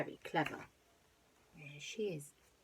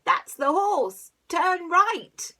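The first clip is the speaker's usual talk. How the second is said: gruff robber voice; 11 semitones higher, 11 dB louder, much slower than usual. Acted. Robber speaks.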